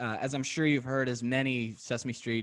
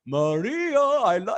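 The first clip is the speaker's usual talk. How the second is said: mock serenade; 9 semitones higher, 6 dB louder, slower than usual. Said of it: "He's just serenading Maria".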